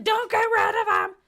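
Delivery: high, loud pleading cry; mock shout. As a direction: shout it to save the games. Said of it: "And I was like".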